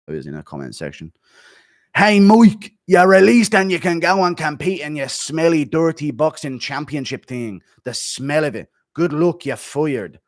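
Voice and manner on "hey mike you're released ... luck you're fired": loud mock Irish taunt, raised and punchy; McGregor voice. Streamer reading McGregor's firing tweet aloud, mock Irish accent. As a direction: bark the firing notice in a hammed-up Irish accent. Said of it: "let me read it in McGregor's voice".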